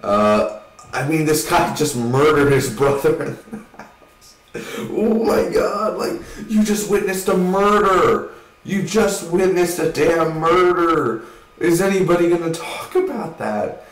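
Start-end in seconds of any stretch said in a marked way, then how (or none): 4.8-13.8 disbelief. Real, not acted. Disbelief at the movie scene.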